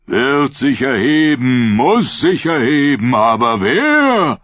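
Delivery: high, loud, slow sermon to the crowd; preaching prophet. Acted. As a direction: proclaim it like a fervent prophet. (Prophet predigt laut und langsam zu seiner Schule)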